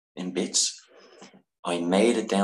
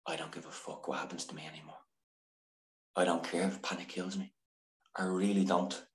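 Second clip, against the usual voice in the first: softer, muttered vow to himself; quiet defiant vow; 10 dB quieter than usual. Retold or imitated. Defiant words to himself at home.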